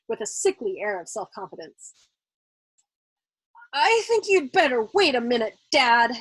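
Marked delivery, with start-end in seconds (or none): 3.7-6.1 hesitant. A narrator's reading. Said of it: "he suggested hesitantly".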